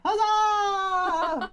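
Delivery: high, loud villager cheer; crowd cheer. Acted.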